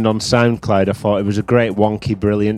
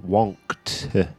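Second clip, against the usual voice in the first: "Wonked" drawn out with a falling tone; stress on Wonked; 5 dB quieter than usual. Shown as a demonstration. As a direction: land heavily on "Wonked". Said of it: "I gotta emphasise the syllables".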